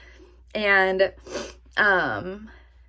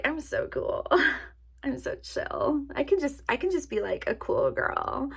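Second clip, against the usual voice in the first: put-on smug cool girl; 6 semitones higher, 4 dB quieter than usual. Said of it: "And I was like".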